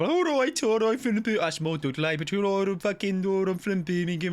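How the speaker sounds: high, exaggerated Brummie caricature; mock Birmingham accent in a high register. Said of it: "that's all the Birmingham people say".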